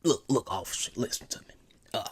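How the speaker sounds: very soft, low, hesitant appeal; polite suspect act. Shown as a demonstration. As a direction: plead meekly with the officer. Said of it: "do just like this".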